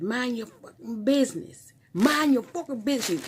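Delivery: high-pitched mock New York yell; yelling New York accent. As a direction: imitate an irate New Yorker yelling. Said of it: "in her New York accent".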